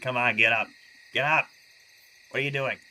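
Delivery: loud, impatient "come on, get up"; exasperated Jesus. Retold or imitated. Jesus scolding the fallen disciples, loud exasperated nudge. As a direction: snap them to their feet, fed up. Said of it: "he's like".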